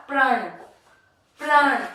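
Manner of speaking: प्रण with र blended onto प, said loud; r after p demo. Shown as a demonstration. Teacher models प्रण for children. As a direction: slide प into र in प्रण. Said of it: "हमने पहले प बोला".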